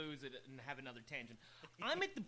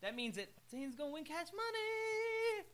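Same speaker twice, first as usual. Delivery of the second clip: gleeful gloating; 9 semitones higher than usual. Acted. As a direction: stretch the final "money" high in celebration. Gloating over possible winnings.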